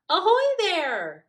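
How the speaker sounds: high, loud hail across the water; shouting. Acted. Hare hails Smudge and Goose from the boat with a loud high shout. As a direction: call out like a pirate. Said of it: "shouted Hare".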